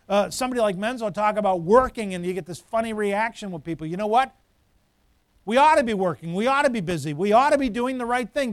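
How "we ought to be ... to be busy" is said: normal talking voice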